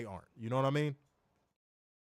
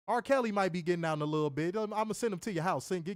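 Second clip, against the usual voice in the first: ordinary talk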